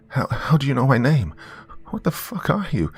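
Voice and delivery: high, panicked pleading; desperate whine. Acted. Trapped girl begs the unseen creature, panicked whine. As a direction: beg to be let out, near tears. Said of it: "I whined desperately, my voice trembling".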